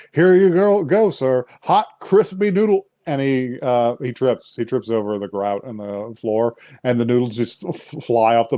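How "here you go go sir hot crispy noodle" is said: higher, louder eager waiter voice; cheery waiter. Retold or imitated. Dad serving the samurai guy, higher eager waiter voice. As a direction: play the overeager server. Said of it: "he's just like".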